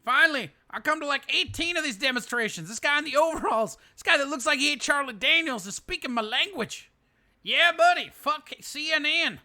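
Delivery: mock rally fan. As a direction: gush like the overall-wearing fan.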